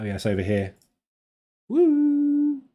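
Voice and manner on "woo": long high-pitched "woo"; playful held whoop. Real, not acted. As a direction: let out a sustained, high woo. Spoken whoop while looking at chart.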